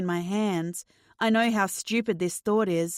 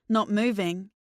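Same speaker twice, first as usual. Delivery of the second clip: plain reading voice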